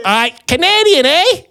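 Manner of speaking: high, hammed-up Canadian accent; put-on Canadian accent. Diner passing himself off to a tourist, fake Canadian accent. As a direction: lay a Canadian accent on thick. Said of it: "I did my best maple syrup".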